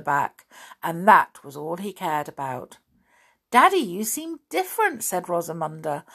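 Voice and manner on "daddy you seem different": put-on high-pitched, lilting child's voice; little girl. Rosamunda speaking to her daddy, little girl voice. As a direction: pitch it up like a young daughter.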